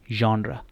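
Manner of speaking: जॉनरा said as "zhon-ra", open o, stress on the first part; correct pronunciation. Shown as a demonstration. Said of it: "इसकी जो सही pronunciation है वो है जॉनरा".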